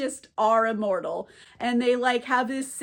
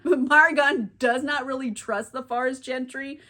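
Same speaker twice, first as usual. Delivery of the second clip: ordinary talk, nothing marked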